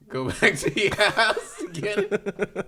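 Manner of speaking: words broken up by real laughing. Real, not acted. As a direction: repeat it through helpless laughter. Cracks up repeating the trick.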